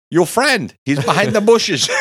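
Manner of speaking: high, loud acted holler; yelled reminder. Doorman shouting to men leaving their friend, yelled reminder. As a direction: holler after people driving off. Doorman yells after departing group.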